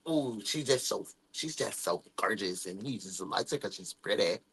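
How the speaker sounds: very low, soft mocking gossip; catty gossip voice. A jealous girl muttering about a rival, low and soft. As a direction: sneer it under your breath like a jealous girl.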